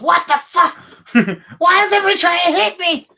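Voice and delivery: high, loud, fast goblin squawk; a barbarian goblin yelling at her own side, high and fast; outraged goblin. Acted. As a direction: squeal in outrage at being attacked. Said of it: "the barbarian goblin goes".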